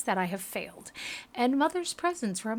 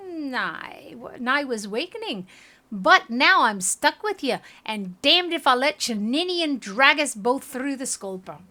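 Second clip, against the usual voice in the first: angry; 4 semitones higher, 6 dB louder than usual. Acted. Aloth furious at spirit.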